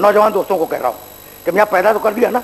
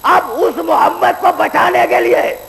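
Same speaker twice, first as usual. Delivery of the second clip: full-force preaching; 8 semitones higher than usual. Acted. Preacher warns the young men about judgement day, in full-force preaching. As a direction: thunder it from the pulpit.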